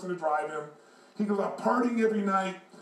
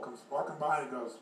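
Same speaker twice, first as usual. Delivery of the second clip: usual speaking voice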